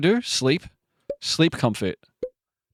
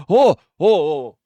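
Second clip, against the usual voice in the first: excited oh; 4 semitones higher, 6 dB louder than usual. Real, not acted. Thrilled at a new game result.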